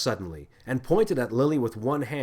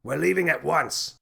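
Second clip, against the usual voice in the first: raised commanding voice; shouted; 4 semitones higher than usual. A narrator's reading. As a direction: give the order curtly. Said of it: "and shouted".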